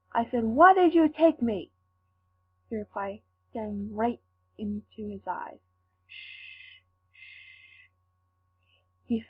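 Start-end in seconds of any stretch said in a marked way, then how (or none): 0.4-1.7 demanding shout. Acted. Captive demands answers from villain. 6.1-7.9 shushing. Acted. Villain hushes, pointing at Mark.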